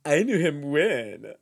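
loud, slow mock boast; mock name-dropping. Hanger-on bragging about a now-famous actor, mock boastful. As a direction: draw out KNEW like a hanger-on. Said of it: "they wanna, like, be like".

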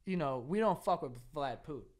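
ordinary delivery

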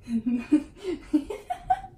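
helpless giggling at oneself; laugh. Real, not acted. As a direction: burst into a run of giggles. Laughing at failing the pose.

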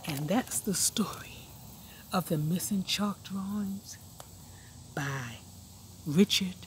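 soft storybook ending; hushed ending. A narrator's reading. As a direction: wind the story down. Closing the story.

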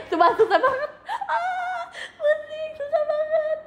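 high, laughing whine; sing-song giving up through giggles; wailing, laughing it's too hard; laughing frustration. Real, not acted.

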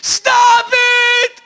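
high urgent yelling from the cab window; frantic yell. Acted. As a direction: shout it like you're panicking, then draw out the meter.

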